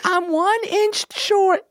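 very high, drawn-out squeak; squeaky little voice; squeaky tiny-person voice. Pretend.

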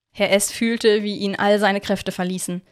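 plain reading voice

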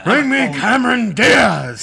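high, slow, loud bellow; loud gung-ho shout. Acted. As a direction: bellow it like a fired-up colonel. Shouted movie impression.

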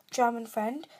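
ordinary delivery

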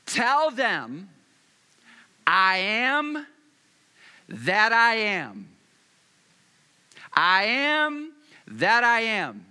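loud, slow, solemn proclamation; God declaring from the bush; booming voice of God. Acted. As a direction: thunder out God's answer. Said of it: "here's what God's reply was".

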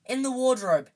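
high, loud urgent order; sharp high burst of alarm; urgent hiss. A narrator's reading. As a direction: hiss it as an urgent, voiced command. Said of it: "hissed Harry".

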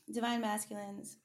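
just after a sip of tea; speaking with a sip of tea just taken; while sipping a drink. Real, not acted. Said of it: "excuse me while I sip my tea".

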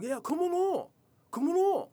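very high, loud taunting call; squeaky mock invitation to come on. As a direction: wave people in with a shrill mock cheer. Mocking careless inviter.

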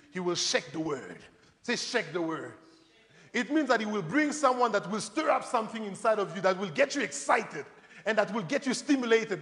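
normal talking voice

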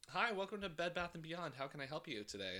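store greeter. Acted. Clerk greeting a Bed Bath and Beyond customer, chirpy store greeter.

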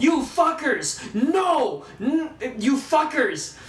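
high, loud, drawn-out exasperation; outraged yelling. Retold or imitated. Ranting at the filmmakers over the reunion, outraged yelling. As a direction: wail in protest, stretching each word. Retelling furious reaction to the scene.

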